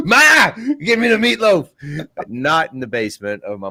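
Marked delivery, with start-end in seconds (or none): 0.1-1.7 put-on high whiny mama's boy voice, laughing; mocking mama's boy. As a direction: whine like a grown man asking mom. Mocking a guy living in mom's basement.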